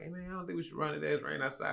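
lightly mocked reluctant texter; reluctant friend. Retells friend's pleading messages.

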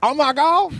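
very high, gushing put-on voice; imitating tickled guards. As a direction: mimic guards thrilled by the accent. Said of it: "they said exactly the same".

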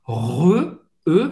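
"Re" with a tight, closed, rounded E. Shown as a demonstration. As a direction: round the lips like O and say E in "Re". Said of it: "Esse E é bem fechado". ("Re" com E bem fechado, boca de O)

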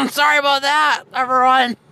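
tipsy and giggling; giggly drunk. Acted. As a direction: laugh through it, a bit tipsy. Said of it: "I am just a little tipsy right now".

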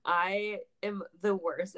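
ordinary talk

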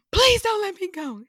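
high, very loud mock pleading scream; panicked yell. Imitating panicked friend in kayak, yelled plea. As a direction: yell it at the top of your lungs. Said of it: "She yells this at the top of her".

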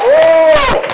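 a very high loud oh; a preacher's ecstatic outburst; ecstatic cry. Real, not acted. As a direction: cry out in rapture. Ecstatic exclamation.

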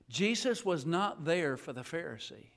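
normal talking voice

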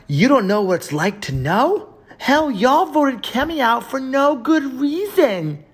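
high mock voice, slow on the second line; mocking whiny voice. Streamer parodying a hater's comment in a high mocking voice. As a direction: ridicule the commenter by squeaking it up.

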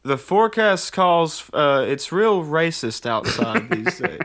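high, slow forecast read; a folksy forecaster joking; TV weatherman voice. Retold or imitated.

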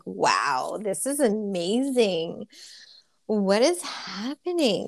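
slow, a touch of hushed amazement; awestruck wonder. Retold or imitated. Guest recalls her meditation bliss, slow amazed wonder. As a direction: marvel at it slowly, lightly acted.

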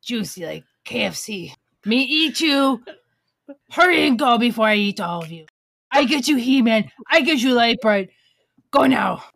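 loud broken-English zombie Santa; growling bugbear. Pretend. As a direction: threaten like a hungry monster. Zombie Santa threatening to eat.

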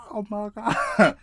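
very high, loud, drawn-out wail; hammed-up horrified squeal; acted-out shock. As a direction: stretch it out high in mock horror. Acts out shock at dream.

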